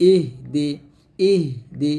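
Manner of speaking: "ইহ দি" with h breathed out, no vowel; "ইহ", a brief breath, then "দি"; correct silent h. Shown as a demonstration. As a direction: stop on an airy h, then "দি". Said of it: "বলতে হবে".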